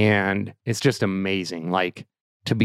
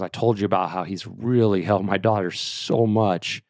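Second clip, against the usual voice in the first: ordinary delivery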